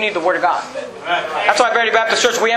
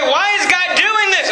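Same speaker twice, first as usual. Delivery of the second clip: high, drawn-out protesting question; hammed-up plaintive why; mock complaint; 8 semitones higher than usual. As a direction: imitate a puzzled churchgoer, pitched up. Acts a questioning churchgoer.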